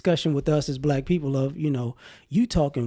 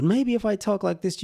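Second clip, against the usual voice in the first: higher, put-on proper voice; code-switched proper voice; 4 semitones higher than usual. Shown as a demonstration. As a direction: lift the pitch into a code-switched voice. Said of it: "you would take me more seriously".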